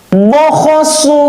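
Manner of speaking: high, crisp Quran letters; fast, firm recitation. Shown as a demonstration. Teacher shows pupils fast, firm letter reading, high and crisp. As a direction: snap out each syllable firmly. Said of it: "Harus gitu".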